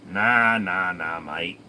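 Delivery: drawn-out Aussie nah nah nah; answering chat in a put-on Aussie accent; Bogan accent. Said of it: "It's a Bogan accent".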